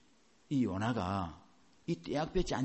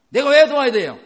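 much higher, loud protesting whine; indignant outburst; 10 semitones higher, 15 dB louder than usual. Retold or imitated. Believer refusing God's command to help, indignant shout. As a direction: throw the refusal back in outrage.

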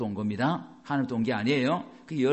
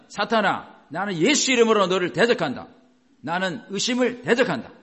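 higher, louder rebuke of Satan in Jesus' name; commanding rebuke; 4 semitones higher, 5 dB louder than usual. Shown as a demonstration. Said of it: "이렇게 싸우셔야 돼요". (높고 크게 사탄을 꾸짖듯)

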